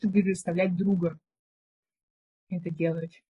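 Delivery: ordinary talk, nothing marked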